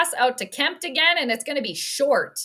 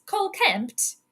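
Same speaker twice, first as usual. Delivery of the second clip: ordinary talk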